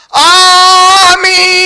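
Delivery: a long, drawn-out yelled amen; mocking the over-the-top shouted amen; screamed amen. Shown as a demonstration. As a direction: shout the amen as loud as you can. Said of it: "ایسا چیخ کے نہیں بولتے تھے".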